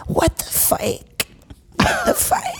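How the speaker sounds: slow, breathy high whisper; airy cartoon exclamation rising to falsetto; Olga. Shown as a demonstration. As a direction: draw it out, soft and squeaky. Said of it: "do that voice a lot".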